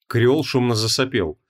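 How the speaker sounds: usual speaking voice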